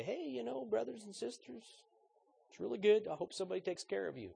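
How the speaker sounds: high, airy, mock-cheerful dismissal; mock breezy brush-off. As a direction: toss it off lightly like you don't care. Mock dismissive greeting to the poor.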